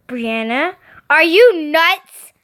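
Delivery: slow, rising to loud outrage; livid. A narrator's reading. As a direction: explode on "are you nuts". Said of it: "I went furious to livid".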